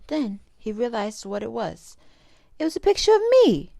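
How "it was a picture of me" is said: high, loud happy realization; overjoyed discovery; joyful. Acted. Child realizes mother loves him.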